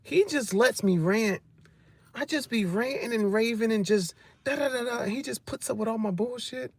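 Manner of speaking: a hint of a happy girlfriend; girlfriend gushing. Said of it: "she be like".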